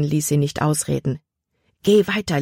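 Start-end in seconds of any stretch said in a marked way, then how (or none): 1.8-2.4 warning. Acted. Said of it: "warnte er".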